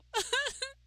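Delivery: high laughing burst. Real, not acted. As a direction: laugh out loud.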